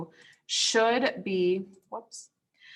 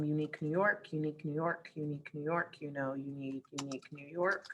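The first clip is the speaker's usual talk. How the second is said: tongue twister practice; 2 semitones lower, 9 dB quieter than usual. Shown as a demonstration. Teacher giving students tongue twister examples, said softly. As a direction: practise the twister quietly to yourself.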